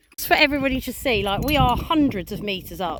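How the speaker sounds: usual speaking voice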